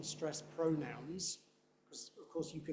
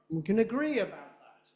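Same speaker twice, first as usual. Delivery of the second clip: "agree" big and loud, "We can" weak; stress on agree; 5 semitones higher, 6 dB louder than usual. Shown as a demonstration. Teacher showing native speech, no stress until agree. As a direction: rush to a loud, high "agree". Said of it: "no stress until you get to".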